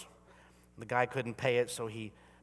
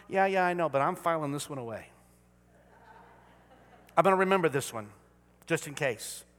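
begrudging; 2 semitones higher, 6 dB louder than usual. Retold or imitated. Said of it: "begrudging people".

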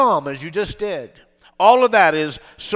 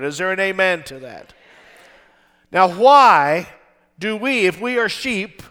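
usual speaking voice

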